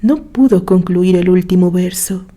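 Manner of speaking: ordinary delivery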